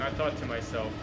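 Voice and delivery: usual speaking voice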